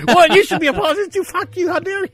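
hammed-up indignant shriek at the wife; mock furious yell. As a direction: shriek it like a wounded toddler. Retold flare-up at wife.

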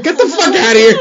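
high, loud mocking yell; mock shout. Doc yelling at a joking soldier, high and loud. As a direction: bark it out like a fed-up doc.

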